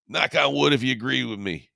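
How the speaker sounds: a hint of the coach firing up the room; imitating Gruden. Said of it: "normally John goes".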